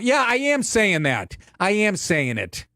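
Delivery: slow, stressed "I am saying", drawn out; defiant emphasis. Acted. Answering imagined critics, slow defiant insistence. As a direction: lean on AM, word by word.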